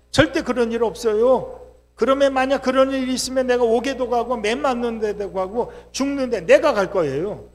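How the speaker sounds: a loud, brash promise; boastful loud claim. Retold or imitated. Peter boasting to Jesus he would die for him, loudly. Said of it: "막 큰 소리 쳤어요".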